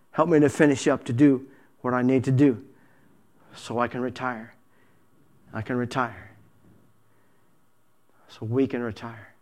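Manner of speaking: low, very soft prayer; hushed, intimate acted prayer; quiet plea. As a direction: let the plea sink almost to a murmur. Acted weary plea to the Lord.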